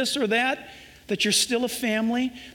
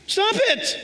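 much higher, sharp stop it; sharp command; 10 semitones higher than usual. Acted. Jesus telling the scared to stop, sharply higher. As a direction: snap it out like a command. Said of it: "he repeats it".

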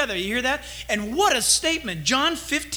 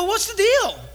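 higher and laughing, incredulous; amazed disbelief; 10 semitones higher, 3 dB louder than usual. Retold or imitated. Pastor retelling his reply to the kid, excited disbelief. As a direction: marvel out loud that he never believed.

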